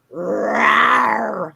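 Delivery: long drawn-out roar; lion roar. Pretend. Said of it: "I don't know how to roar".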